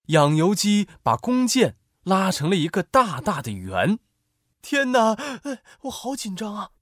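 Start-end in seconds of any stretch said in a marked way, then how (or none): 4.6-6.7 high, hushed suspense; anxious, higher-pitched half-hushed voice; nervous onlooker. Acted. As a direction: fret softly as a nervous soldier watching. Soldiers watch the shot nervously.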